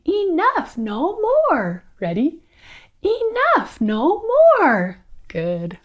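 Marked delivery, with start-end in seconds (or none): 0.1-1.8 high, frustrated fairy cry. Shown as a demonstration. Storyteller showing children the fairies' line, frustrated cry. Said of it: "do it like you're frustrated". 3.0-5.0 very high, frustrated exclamation. Shown as a demonstration. Storyteller saying it with the children, frustrated high cry. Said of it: "do it like you're frustrated".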